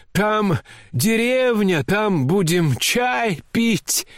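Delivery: high, slow, despairing plea; utter despair. A narrator's reading. General still luring grandmother away, in high drawn-out despair. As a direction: drag out the coaxing desperately, voice way up. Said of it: "продолжал генерал уже с полным отчаянием".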